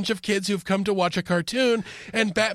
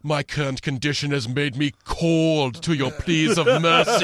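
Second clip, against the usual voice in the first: imitating Mr. Freeze; 7 semitones lower than usual. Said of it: "he's like".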